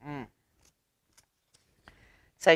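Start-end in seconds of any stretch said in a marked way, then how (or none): none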